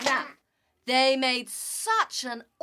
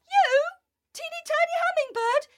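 incredulous high squeak; 4 dB louder, faster than usual. Acted. Animals doubt the hummingbird.

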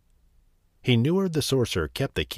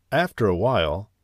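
usual speaking voice

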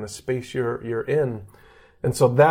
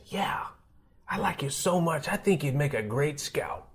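a lightly voiced old scout, softer; old scout's voice; 3 semitones higher, 7 dB quieter than usual. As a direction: let the old scout drop the news gently. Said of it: "and he says".

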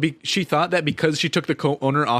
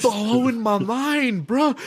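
very slow, high-pitched sarcastic wonder; mock amazement; 9 semitones higher, much slower than usual. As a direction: drag it out as if mind-blown.

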